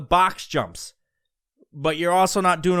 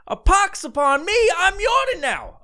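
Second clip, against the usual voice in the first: mock cursed wizard; 13 semitones higher than usual. Mock wizard cursed by yawn spell.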